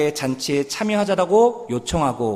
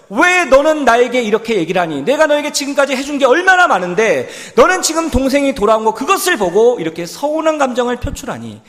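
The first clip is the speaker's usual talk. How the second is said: higher, louder mock rebuke; imagined scolding; 9 semitones higher, 8 dB louder than usual. Father to resentful elder son, a loud mock scolding. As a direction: act out the scolding the father never gave. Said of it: "아버지가 그 큰아들을 꾸짖지 않았다라는 거예요".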